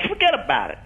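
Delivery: Irish American accent, "Forget about it" lifting up at the end. Shown as a demonstration. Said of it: "the Irish all goes up".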